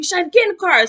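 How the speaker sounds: high, loud repeated yell. Retold or imitated. Relatives shouting at her to get in the car. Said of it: "Everybody yelling at me".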